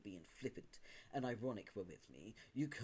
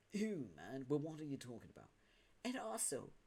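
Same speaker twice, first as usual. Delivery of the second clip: ordinary talk, nothing marked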